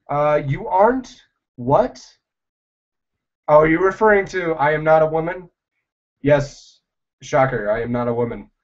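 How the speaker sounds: ordinary talk, nothing marked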